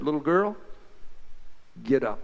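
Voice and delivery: put-on low, soft call to a child; tender command. Jesus softly telling the little girl to rise, tender. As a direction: wake her gently.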